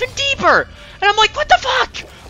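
high, loud shrieks of pain and outrage; pained shrieking. Retold or imitated. Patient yelling at the doctor, shrieking high and loud. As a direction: screech it like the cut won't stop. Retelling painful scalpel cut.

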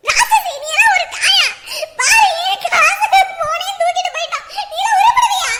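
high, loud, shouted curses; furious scolding. Acted. Mother-in-law screaming curses at her daughter-in-law after the theft. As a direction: scream curses at her, half laughing in fury.

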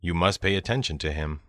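usual speaking voice

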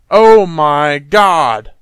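slow, loud, drawn-out shock; dramatic gasp. Acted. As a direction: stretch it out as the horror dawns. Dramatic realization in game dialogue.

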